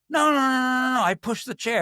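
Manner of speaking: high, rapid-fire denial; indignant protest. Retold or imitated. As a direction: protest over the accusation in a high voice. Retold protest at being accused of yelling.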